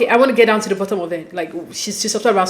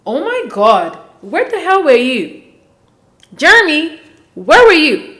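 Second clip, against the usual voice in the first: raised, exasperated outcry building in pitch and volume; exasperated demand; 5 semitones higher, 3 dB louder, slower than usual. Acted. Viewers calling Jeremy out over his night away, exasperated. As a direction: demand answers, pitch leaping up.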